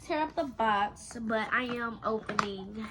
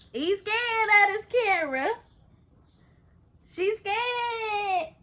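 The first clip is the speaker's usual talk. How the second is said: playful squeal; 9 semitones higher, 7 dB louder than usual. Acted. Playful mock fear.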